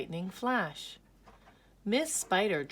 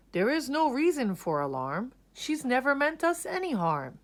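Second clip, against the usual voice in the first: higher, louder reassuring voice; lightly voiced beetle calming friends; 7 semitones higher, 4 dB louder than usual. A narrator's reading. May telling the bugs not to fear, lightly voiced reassurance. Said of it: "went from bug to bug to say".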